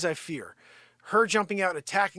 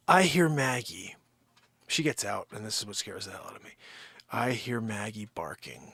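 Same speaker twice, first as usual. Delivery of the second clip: ordinary talk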